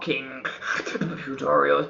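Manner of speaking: loud announcer. Acted. Show title shouted.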